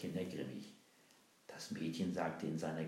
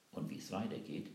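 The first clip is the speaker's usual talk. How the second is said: plain reading voice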